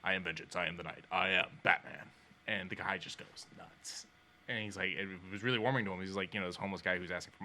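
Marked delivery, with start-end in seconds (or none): 0.0-2.1 low, measured superhero declaration; slightly lowered Batman impression. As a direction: imitate Batman, building on the last line. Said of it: "Do the voice".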